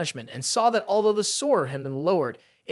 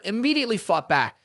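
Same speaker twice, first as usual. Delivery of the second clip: usual speaking voice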